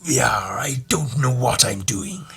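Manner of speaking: slow, rough cartoon sea-dog voice; hammy, stretched-out seafarer drawl; terrible sea captain. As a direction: do a bad old-salt impression, drawn right out. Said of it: "that was a terrible impression".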